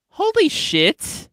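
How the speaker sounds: slow, high, drawn-out amazement; hammed-up dawning realization, loud and high; mock astonishment. Mimicking a friend who finally notices an accent, mock amazed.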